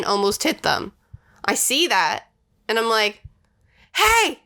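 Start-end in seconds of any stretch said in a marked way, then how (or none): 3.9-4.4 sharp high shout of a stern mom; scary mom voice. Retold or imitated. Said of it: "in my mom's scary voice".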